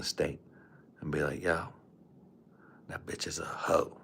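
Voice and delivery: low, hushed whisper into an ear. Retold or imitated. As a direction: imitate a secretive pillow-talk whisper. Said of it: "I was whispering in her ear".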